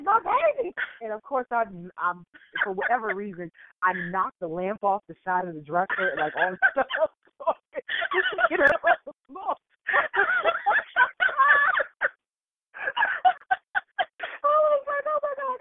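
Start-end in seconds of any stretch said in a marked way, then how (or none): 0.0-0.7 put-on high, loud cry of my baby; mock panic; panicked cry. 7.5-9.5 high laughing through the words; giggling while telling it. Real, not acted. 9.9-12.1 high, loud shriek of laughter. Real, not acted. 13.4-15.6 high, slow laughing oh my God; drawn out through laughter. Retold or imitated. Laughing retold horror.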